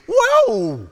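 loud, high burst of amazement; a stunned, loud, high whoa; amazed whoa. Pretend. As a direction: gasp it out like you just saw the tomb empty. Imagined reaction at empty tomb.

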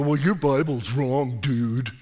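lightly voiced smug arguer, slowed down; mocking know-it-all. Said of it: "they go".

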